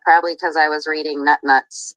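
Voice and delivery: ordinary talk, nothing marked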